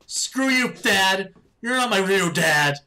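loud, high bratty outburst; mock angry yell. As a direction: yell it like a sulky teen. Acted teen outburst at dad.

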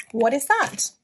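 normal talking voice

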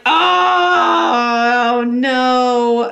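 long drawn-out "oh no"; exaggerated dismayed wail; mock dismay. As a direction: stretch out a hammed-up lament. Mother acts dismay at Santa.

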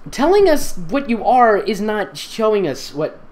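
ordinary delivery

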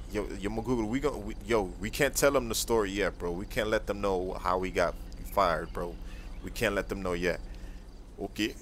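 low, soft secretive tone; hushed. Acted. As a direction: keep it down, nobody can know. Secret about getting fired.

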